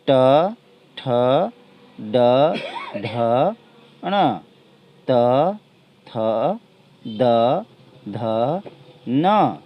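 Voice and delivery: each consonant said alone with long pauses; teacher repeating the consonant chart; repeat consonant drill. Shown as a demonstration. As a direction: read the letters again for pupils to copy. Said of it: "फिर से मैं पढ़ता हूँ".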